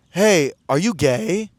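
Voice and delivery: slow, stretched imitation of a stranger asking; drawn-out question. Guy taps him on the shoulder at the club, drawn-out question. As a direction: draw the question right out. Stranger's first, tentative approach retold.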